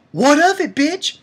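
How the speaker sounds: high, loud, cocky comeback; brash, high-pitched defiance; defiant challenge. Real, not acted. Defiant challenge after his claim.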